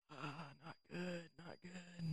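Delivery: very soft, slow mock dejection; dejected. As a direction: sigh it out quietly, imitating a crushed friend. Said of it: "And he's like".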